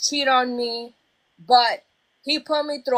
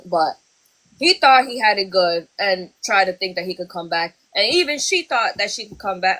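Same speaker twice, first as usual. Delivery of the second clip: ordinary talk, nothing marked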